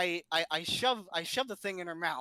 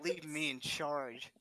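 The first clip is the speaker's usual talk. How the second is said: mock indignation; 5 semitones lower, 5 dB quieter, slower than usual. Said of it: "the nonchalance in his voice".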